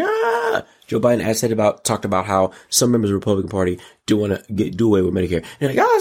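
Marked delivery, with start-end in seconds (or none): 0.0-0.6 long high-pitched scream; mock scream. People screaming at the State of the Union, mock scream. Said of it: "People screaming". 5.8-6.0 high-pitched yelp of outrage; mock scream. Republicans reacting to Joe Biden, high mock scream.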